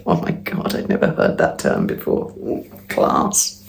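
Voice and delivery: hushed unvoiced "oh my God" aside; whispered shocked aside. Real, not acted. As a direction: drop to a whisper, scandalised. Shocked aside about the term.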